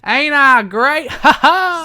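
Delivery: loud, high, drawn-out wrestler boast; Jeff Jarrett. Acted. Co-host mimics the wrestler's catchphrase, loud high boastful voice. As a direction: crow it with a big mock laugh. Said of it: "impression of Jeff Jarrett".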